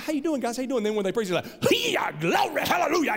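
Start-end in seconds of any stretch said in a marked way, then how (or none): 0.0-0.8 higher-pitched mock preacher small talk; preachers' talking voice. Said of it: "they talk to you like this". 1.7-3.2 high, strained mock revival holler; whole voice changes into preaching scream; screaming preacher. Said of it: "they start screaming. Their whole voice changes".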